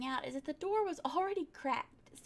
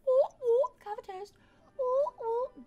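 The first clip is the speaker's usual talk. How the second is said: excited squeals; 7 semitones higher, 8 dB louder, slower than usual. Real, not acted. Excited at toast.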